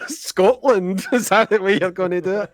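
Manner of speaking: laughing through the words; amused, laughing question. Real, not acted. Laughing at friend's accent attempt.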